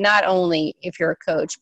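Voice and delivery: normal talking voice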